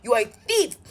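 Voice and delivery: loud, emphatic insults; reenacted angry name-calling; retold cussing out. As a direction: cuss him out, punching each insult loudly. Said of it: "I cussed him".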